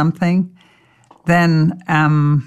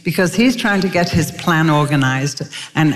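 ordinary delivery

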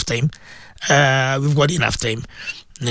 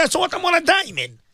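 careless mock quote; 8 semitones higher, 3 dB quieter than usual. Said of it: "you were saying".

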